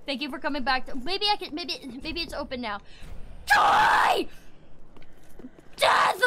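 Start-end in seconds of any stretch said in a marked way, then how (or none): 3.5-6.2 very high, harsh shriek; shrieked war cry; battle scream. Real, not acted. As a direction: scream it as you attack. Attacking enemies in game.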